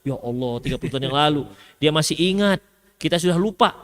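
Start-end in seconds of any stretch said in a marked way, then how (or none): 0.0-1.4 much lower, softer, weary disbelief; lightly voiced exasperated husband; exasperated groan. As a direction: sigh it out like a worn-down husband. Husband groans at old grievance.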